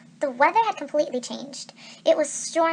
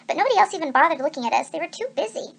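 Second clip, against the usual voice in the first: ordinary delivery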